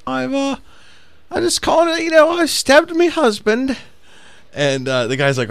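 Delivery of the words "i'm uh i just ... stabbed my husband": high-pitched, hammed-up posh British lady; badly faked elegant British housewife on the phone; chill British lady. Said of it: "can't do the real elegant, uh, British voice".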